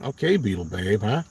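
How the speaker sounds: ordinary talk, nothing marked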